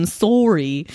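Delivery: mock Canadian "sorry", vowel rounded. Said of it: "she doesn't say sorry like you would hear Canadians".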